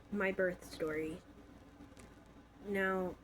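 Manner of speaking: usual speaking voice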